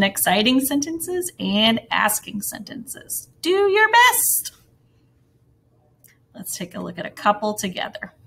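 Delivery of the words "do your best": high, loud, drawn-out send-off; cheery encouragement. Real, not acted. Teacher urging first graders on before practice, cheery. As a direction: cheer them on, slow and high.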